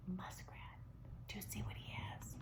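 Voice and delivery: hushed conspiratorial whisper. A narrator's reading. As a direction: lean in and whisper the secret. Hushed reveal to listeners.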